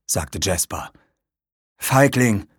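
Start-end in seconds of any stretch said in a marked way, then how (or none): 1.8-2.5 mildly scornful, pitch a little raised; jeering. A narrator's reading. Cal taunts his friend for wanting to flee, jeering. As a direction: throw the insult as a taunt. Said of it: "höhnte Cal".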